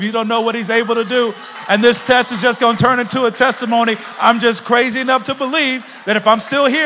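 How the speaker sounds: loud, high, shouted preaching; preaching at full force. Acted. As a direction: build the crowd up, shouting. Preaching climax, shouted lines.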